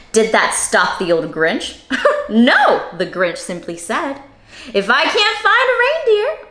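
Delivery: lively read-aloud storytelling voice; reading to children. A narrator's reading. Teacher reading the Grinch to children, lively and animated. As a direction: read it bright and animated for little listeners.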